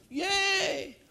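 high sarcastic cheer; a sarcastic squeal of applause; mock cheer. Mocking the crowd's applause.

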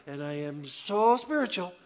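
slow, drawn-out self-satisfied boast; mock smug spirituality. Pastor mocking proud believers, slow and smug. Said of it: "somehow I'm making light".

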